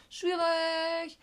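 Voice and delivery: very high, long drawn-out squeal of a word; high-pitched sing-song understatement; mock singsong. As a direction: stretch it out on one high held note. Retold reaction to alarming ward.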